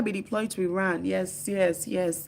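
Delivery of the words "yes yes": usual speaking voice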